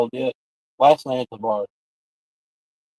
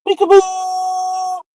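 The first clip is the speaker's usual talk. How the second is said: high, long drawn-out holler; playful peekaboo call; 3 dB louder, much slower than usual. Pretend. Calling out peekaboo, high drawn-out playful call. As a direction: sing it out high, holding the last vowel.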